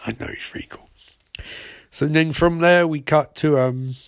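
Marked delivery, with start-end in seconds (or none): 0.0-0.9 whispered agreement. Retold or imitated. She agrees about Flash.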